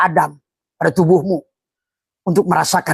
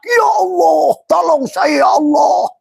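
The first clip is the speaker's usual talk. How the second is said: desperate cry to God; 13 semitones higher, slower than usual. Retold or imitated. Mocking a struggler crying out to God. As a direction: cry out for help, voice straining high. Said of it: "dia teriak".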